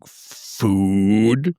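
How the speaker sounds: drawn-out questioning grunt word; lightly voiced hungry monster asking; shark-man character. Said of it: "he's like".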